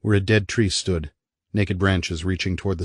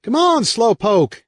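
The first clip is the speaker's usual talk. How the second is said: put-on high, louder, slow playful call of a little boy; laughing shout; 6 dB louder, slower than usual. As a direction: kid calling his brother to hurry up. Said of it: "Eric shouts, laughing".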